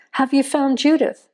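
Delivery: mother begs the sheriff, a hint of breathless desperation; breathless plea. A narrator's reading. Mother asking the sheriff about her daughter, mildly desperate. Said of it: "asked breathlessly".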